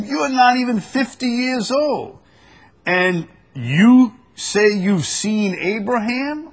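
high, loud voice of disbelieving questioners; incredulous challenge. Acted.

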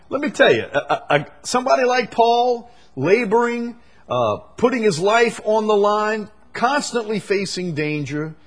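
high, forceful pulpit voice, easing off at the end; impassioned preaching. Acted. Preacher on Paul laboring unsupported by his churches, impassioned. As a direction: preach it with urgency, pitched high.

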